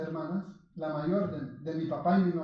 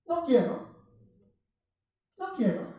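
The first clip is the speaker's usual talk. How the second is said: put-on child's refusal; 7 semitones higher than usual. Toddler refusing a parent's command.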